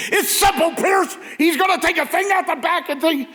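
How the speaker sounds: high, loud furious shout; angry yelling. Retold or imitated. Richard Widmark yelling at the nervous actor in a scene, furious shout. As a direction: bark the line at the nervous actor. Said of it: "he goes".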